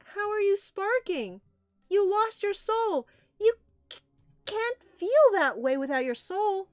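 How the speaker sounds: high, loud, alarmed rabbit; shrieky, incredulous outburst; shocked disbelief. Acted. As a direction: demand it in astonishment. Other rabbit reacts in disbelief.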